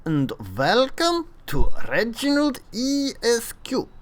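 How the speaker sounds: hammed-up German accent, high and slow; terrible German accent. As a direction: play a cartoonish German tank reviewer. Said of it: "I thought I'd give a German accent a go".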